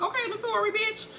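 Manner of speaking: high-pitched teasing praise; impressed. Retold or imitated.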